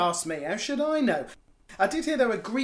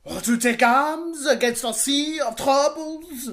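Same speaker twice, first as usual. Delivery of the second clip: put-on French accent; 5 semitones higher, 5 dB louder, slower than usual.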